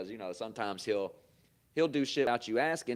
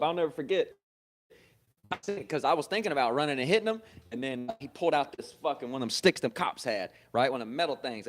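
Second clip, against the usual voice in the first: ordinary delivery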